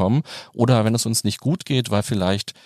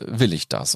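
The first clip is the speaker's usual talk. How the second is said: „Will“ betont, fallend; stress on WILL; 6 semitones lower than usual. Shown as a demonstration. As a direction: das Wort „Will“ hervorheben. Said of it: "wo man die drei Wörter verschieden betont". (Betonung auf „Will“)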